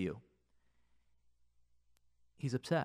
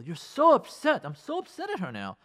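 resentful complaint; 9 semitones higher than usual. Retold or imitated. Voicing resentful spouse.

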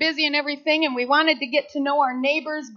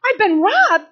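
high loud shocked cry; shocked disbelief; 7 semitones higher, 9 dB louder, slower than usual. Retold or imitated. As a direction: gasp it out in disbelief. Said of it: "I'm like".

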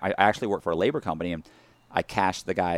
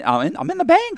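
much higher, louder; sudden dread, much higher; alarmed realization; 7 dB louder than usual. Real, not acted. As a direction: squeak of panic. Realizing, panicked.

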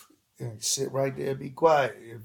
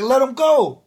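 high, loud call across the lot; loud retold call across a parking lot; 10 semitones higher, 8 dB louder than usual. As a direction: shout it so he hears. Vic calling to dog owner.

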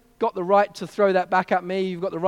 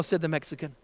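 normal talking voice

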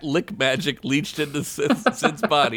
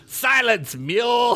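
maniacal command; 11 semitones higher, slower than usual. Acted. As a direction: snap it like a mad master. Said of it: "maniacal laugh".

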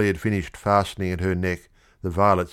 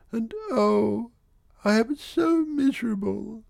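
high, breaking tearful wail; a pitiful sob through the words; girl near tears at her misery; sobbing pitifully. Acted. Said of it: "The little Duchess was sobbing pitifully".

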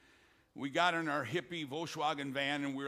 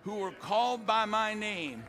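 higher, louder, slowed preaching over music; rising altar call; 3 semitones higher, 3 dB louder, slower than usual. Acted. Preacher calling the nation back to God, stirring climactic preaching. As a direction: lift it into a climactic appeal.

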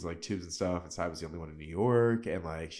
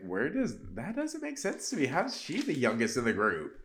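baffled, much higher, asking the room; incredulous disbelief; 6 semitones higher than usual. Retold or imitated. Recapping the show to viewers, incredulous much higher voice. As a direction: pitch jumps way up in mock disbelief. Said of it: "I'm like".

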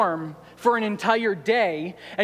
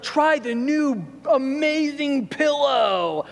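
higher, slow hammed-up TV pitchman; cheesy infomercial voice; 6 semitones higher, slower than usual. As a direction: sell it like a bad late-night ad. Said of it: "those really bad infomercials on T V".

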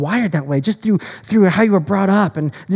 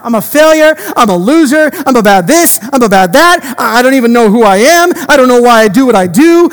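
loud, mounting rant of self-blame; anguished outburst; 3 semitones higher, 6 dB louder than usual. Acted. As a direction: hurl each confession out loudly. Acted outburst of self-condemnation to God.

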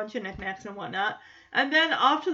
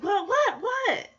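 alarmed mock waking; 8 semitones higher, slower than usual. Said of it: "She's probably thinking the worst".

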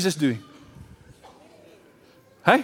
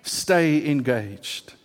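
slow insistence; 6 semitones lower, much slower than usual. Retold or imitated. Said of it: "He's just saying".

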